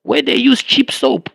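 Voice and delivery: high, put-on voice mocking a rude American; mocking American accent. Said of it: "he was like".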